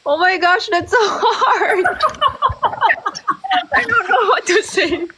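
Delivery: high-pitched helpless laughter through the words; laughing, flustered. Real, not acted. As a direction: crack up while admitting you're stumped. Flustered laugh at hard question.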